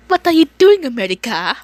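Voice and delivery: put-on high-pitched Slavic-accented question. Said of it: "Slavic accent".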